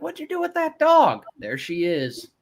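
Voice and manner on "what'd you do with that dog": much higher, louder imitation of viewers; mock viewer outcry. Host imitating viewers asking about the dog, high mock outcry.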